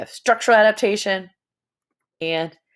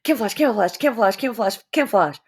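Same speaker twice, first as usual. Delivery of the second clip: tongue twister; 3 semitones higher, much faster than usual. Shown as a demonstration. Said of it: "say camouflage five times fast".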